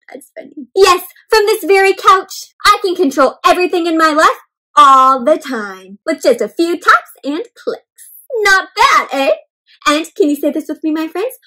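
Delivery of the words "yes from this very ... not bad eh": a bragging lazy king, higher and louder; a couch potato boasting to the reader; boastful couch potato. Acted. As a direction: show off the couch like a throne. Couch Potato's lines in book.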